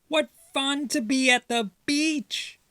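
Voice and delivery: high squeaky mouse voice, delighted; little mouse. Acted. Little mouse cheering to siblings in the water, high and squeaky.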